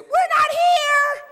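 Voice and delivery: very high, drawn-out shout; little kid's holler. Acted. Little brother answers military police at door, hollering high. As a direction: holler it in a squeaky child voice.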